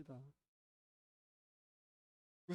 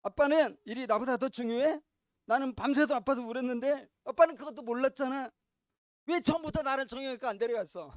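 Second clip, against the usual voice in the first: put-on child's complaint; 6 semitones higher, 11 dB louder than usual. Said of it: "넌 이렇게 말해야지".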